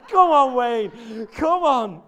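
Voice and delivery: loud call-out. Real, not acted. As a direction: call out loudly to Wayne through laughter. Calls out to Wayne, laughing.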